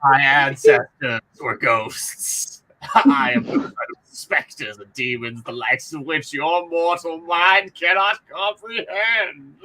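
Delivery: slow, drawn-out ghostly menace; eldritch horror. Pretend. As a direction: intone it like an ancient specter. Playing an ominous ghostly being.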